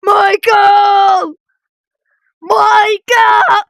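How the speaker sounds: drawn-out mock-desperate shout; calling out. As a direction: cry out after a friend leaving you. Calling out to a character.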